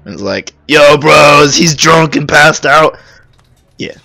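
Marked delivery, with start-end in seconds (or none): 0.7-3.0 loud, drawn-out frat bro call; frat guy. Retold or imitated. Imagined frat guy calls his bros over, hollering in bro voice. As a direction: holler like a party bro rallying his friends. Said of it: "He must have been a frat guy".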